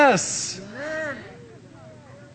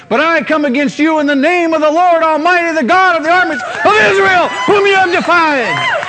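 rising, louder defiant cry; bold proclamation; 4 semitones higher, 4 dB louder than usual. Acted. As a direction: proclaim it like a warrior facing a giant. Preacher proclaims David's challenge to Goliath.

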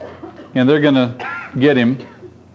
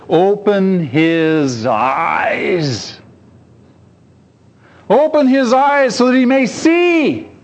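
earnest prayer; 3 semitones higher, much slower than usual. A narrator's reading. Prophet's prayer.

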